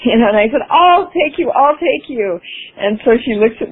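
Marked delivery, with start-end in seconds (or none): 0.7-1.5 high, eager "I'll take you"; eager volunteering. Retold or imitated. 1.5-2.4 eager repeat. Retold or imitated. Eagerly volunteering for the ride.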